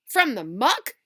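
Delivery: loud, rising yelled question. Acted. Alan yells at Joe about where the worm came from, loudly. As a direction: yell it out in alarm. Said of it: "yelled Alan".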